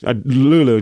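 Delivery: ordinary talk, nothing marked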